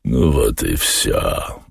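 low, slow character voice; Воланд. A narrator's reading. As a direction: wrap it up low and unhurried. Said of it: "закончил Воланд". (низко и медленно)

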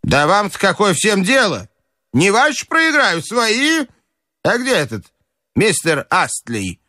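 high, indignant grandmother; бабушка огрызается. Acted. Grandmother retorts, then asks. (высокий возмущённый голос бабушки)

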